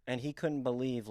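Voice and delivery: normal talking voice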